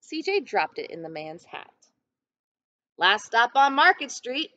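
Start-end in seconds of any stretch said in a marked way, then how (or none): 3.0-4.5 louder, higher driver's call down the bus. A narrator's reading. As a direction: announce the stop to the whole bus. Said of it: "Mister Dennis called".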